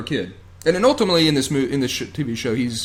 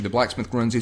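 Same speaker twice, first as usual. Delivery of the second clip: ordinary talk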